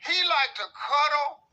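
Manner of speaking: slow, high and loud whiny drawl; whiny lover. Acted. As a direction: drag each word out like a needy whine. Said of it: "What does that sound like?".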